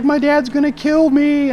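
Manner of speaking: high, loud, slow mock wail; girl crying to the officer, mock wail; imitating a sobbing girl. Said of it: "She's like".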